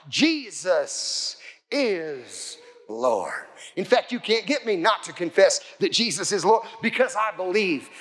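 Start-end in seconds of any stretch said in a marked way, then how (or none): 0.1-3.4 very slow, lower, each word drawn out; solemn declaration. Acted. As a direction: proclaim it one long word at a time. Speaks the confession with his mouth.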